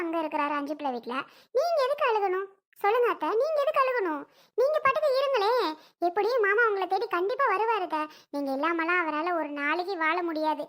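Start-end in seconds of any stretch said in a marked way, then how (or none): 1.6-8.0 higher, louder urging; insistent pleading. Acted. Daughter-in-law urging weeping mother-in-law, higher and louder. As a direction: press her to stop crying.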